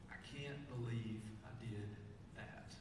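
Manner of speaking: barely voiced disbelief at one's failure; soft regret. Preacher voicing a listener's regret, softly.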